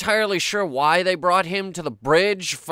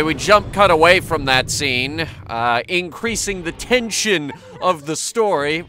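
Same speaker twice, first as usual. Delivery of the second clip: usual speaking voice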